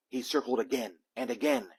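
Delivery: usual speaking voice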